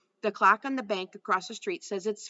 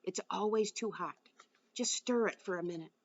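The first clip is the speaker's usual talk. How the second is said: hushed, much softer whisper; 6 dB quieter than usual. Acted. Blind girl whispering to the invisible boy over cocoa. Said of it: "when she whispers".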